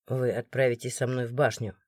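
cold authority, no need to shout; stern guard. A narrator's reading. The guard questioning the crowd, low stern voice. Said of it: "всё так же строго продолжил Морган".

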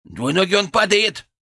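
high, loud cry of alarm; alarmed yell. A narrator's reading. Фырк warns that he is falling, alarmed yell. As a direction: yell a sudden warning. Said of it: "завопил Фырк".

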